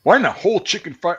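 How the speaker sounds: drill sergeant yelling. Retold or imitated. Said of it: "he goes".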